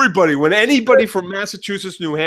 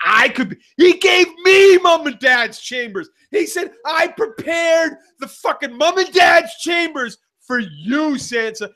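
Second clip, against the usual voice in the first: high, indignant rant building to shouts; hammed-up fury, shouting the key grievances; Sansa's mock outrage; 8 semitones higher than usual. Acting out Sansa's anger over mom and dad's chambers, mock outrage. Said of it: "It turned from like".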